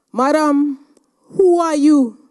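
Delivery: loud insistent challenge; insistent demand. Retold or imitated. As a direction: press her, raising the voice. President repeats her question.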